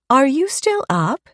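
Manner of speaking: put-on high, surprised child voice; a young girl caught out of bed; little girl voice. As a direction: daughter calling down the stairs, high child voice. Daughter Mary on the stairs.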